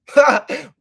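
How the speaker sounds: loud, high burst of laughter; one sudden high laugh; short laugh. Real, not acted. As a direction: let out a quick, loud laugh. Joking after darkness remark.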